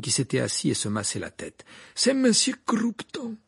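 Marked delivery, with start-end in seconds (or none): 1.9-3.4 high, loud, slow character voice; name the culprit slowly; accusing Krum. Acted. Krum names his attacker to Karkaroff, accusing.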